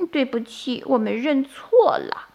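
small, high, sheepish voice; embarrassed apology. A narrator's reading. Baby turtles apologise to crab mother, sheepish. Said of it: "不好意思地说".

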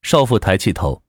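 plain reading voice

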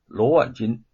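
ordinary delivery, nothing marked

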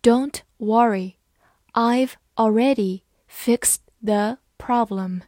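word by word with clear pauses; deliberate, evenly spaced teaching pace; slow reading. Shown as a demonstration. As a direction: read it out slowly for learners. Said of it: "好，慢慢来读".

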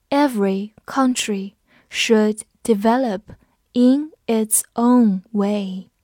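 slow, careful, word by word; slow reading. Shown as a demonstration. Teacher reading the sentence slowly for students. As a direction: read it slowly for learners. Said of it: "我们慢读一遍".

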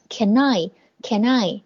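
Can I run together as one word, kan-nai; linked can I. Shown as a demonstration. Teacher showing students how to link can and I. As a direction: glide the n of Can straight into I. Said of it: "can和I要最好把它连读起来".

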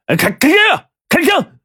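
very high frantic yell; roaring. A narrator's reading. Boss bursts in and roars at him to shoot. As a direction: scream the order to fire. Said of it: "大吼了一声".

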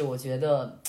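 ordinary talk

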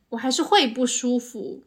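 usual speaking voice

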